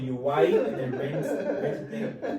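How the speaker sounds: high, laughing through the words. Real, not acted. Speaker laughing at his own tangent, high giggle. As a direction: giggle as you lose the thread.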